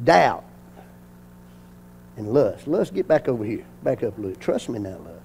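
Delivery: very low, soft play-acted murmur; quietly manage unruly visitors at the door; pretend hushed aside. Acts quietly talking to visitors at door.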